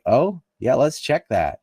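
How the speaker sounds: high, loud, sarcastic mock eagerness; mock sarcastic agreement. British officials mockingly agreeing to check the claim, sarcastic. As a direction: mimic a smug official brushing it off. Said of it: "the British were like".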